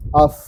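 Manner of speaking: "Af" ending on a pure f, no vowel; vowelless final f. Shown as a demonstration. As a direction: close "Af" on a drawn-out f. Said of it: "tidak ada bunyinya".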